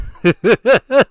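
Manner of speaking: high, loud hammed-up villain cackle; creepy laugh. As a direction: cackle to unsettle the listener. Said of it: "feel a little threatened".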